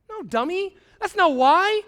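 high, loud correction; emphatic scolding. Acted. Pastor rejecting fear as his motive, scolding. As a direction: knock the idea down forcefully.

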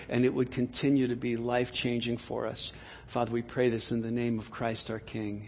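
soft, low prayer voice. Acted. Pastor praying over the congregation, soft and low. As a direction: pray it quietly and earnestly.

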